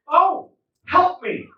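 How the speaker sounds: high, loud, drawn-out plea for help; pleading old lady. Retold or imitated. Old lady begs a visitor for help, high mock plea. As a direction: mimic a helpless old woman calling out slowly.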